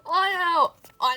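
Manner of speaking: loud fangirl shout; delighted shout. Real, not acted. Fan reacting to photo card, shouting with joy. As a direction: cry out in happy disbelief.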